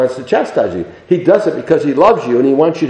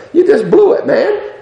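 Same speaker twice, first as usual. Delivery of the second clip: high, laughing rebuke; laughing mock voice of the Spirit; 13 semitones higher than usual. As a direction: tease the listener with a chuckle. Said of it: "His Spirit is telling us".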